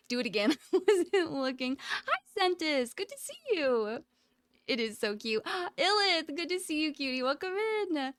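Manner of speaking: ordinary talk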